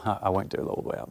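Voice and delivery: ordinary delivery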